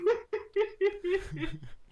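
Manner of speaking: high, loud real laughter; long helpless laugh. Real, not acted. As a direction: crack up mid-story. Cracks up at own story.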